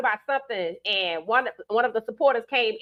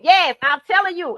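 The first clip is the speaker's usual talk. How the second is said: emphatic insisting; 7 semitones higher, 6 dB louder than usual. Real, not acted. Insisting to viewers.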